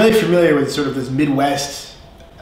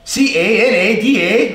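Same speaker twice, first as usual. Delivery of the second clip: loud, higher Canadian "eh" after letters; Canadian accent; 6 semitones higher, 5 dB louder than usual. Acted. Teacher delivering a joke's punchline, loud Canadian accent. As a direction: spell it as a hammed-up Canadian. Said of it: "How do Canadians spell Canada".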